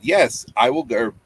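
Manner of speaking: usual speaking voice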